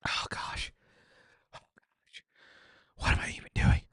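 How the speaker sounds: voiceless whisper, fading away; pained whisper. Real, not acted. Asking himself why he eats wings, pained whisper. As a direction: barely breathe the words out.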